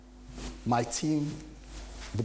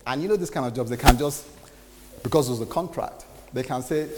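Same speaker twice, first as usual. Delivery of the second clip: ordinary talk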